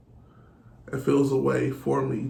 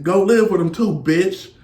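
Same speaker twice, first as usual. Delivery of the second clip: retold angry yell; 6 semitones higher, 8 dB louder than usual. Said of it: "she like".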